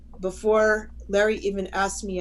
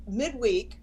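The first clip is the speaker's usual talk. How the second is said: ordinary talk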